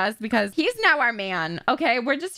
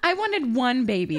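usual speaking voice

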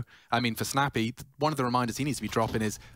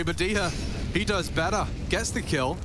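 normal talking voice

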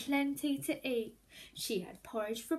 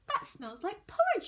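Hannah's voice; 7 semitones higher, 4 dB louder than usual. A narrator's reading. Hannah thinks aloud.

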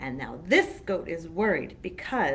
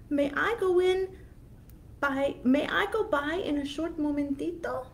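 timid goat; 7 semitones higher than usual. Acted. Tiny goat begs the troll.

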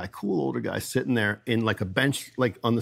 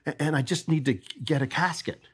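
lightly voiced old man, a bit higher; a hint of a hesitant old customer; nervous old guy; 4 semitones higher than usual. Old customer Gary explaining his old case, in a lightly higher hesitant voice. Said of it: "And he goes".

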